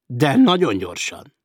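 loud, slow, emphatic order; emphatic shout at a suspect; shouted command. A narrator's reading. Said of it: "kiáltott Sedlinz".

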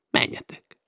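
hushed whisper, sending them away; whispered urgent order. Acted. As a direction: breathe the word out as a command. Rusty sends them off quietly.

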